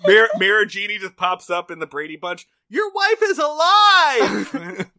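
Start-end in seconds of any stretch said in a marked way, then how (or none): 2.7-4.9 high, slow screaming yell; mock yell. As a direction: bellow it out stretched and shrill. Mirror Genie yells at Brady Bunch.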